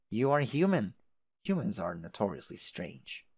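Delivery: usual speaking voice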